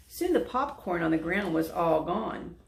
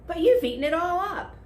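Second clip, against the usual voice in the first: loud, much higher complaint; cried; 11 semitones higher, 7 dB louder, faster than usual. A narrator's reading. Animals crying out that the popcorn is gone. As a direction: whine it out loud. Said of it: "the elephant cried".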